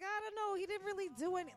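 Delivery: high, loud mock waffling; exaggerated high-voiced excuse; mock dithering. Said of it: "that's how they're acting".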